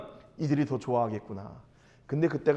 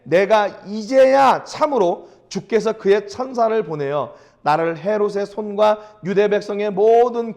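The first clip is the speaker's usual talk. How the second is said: loud, slow, raised proclamation; fervent confession; 4 semitones higher, 6 dB louder than usual. Acted. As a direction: proclaim it with awe. Peter's confession read with fervor. (베드로의 고백을 크고 느리게 선포하듯)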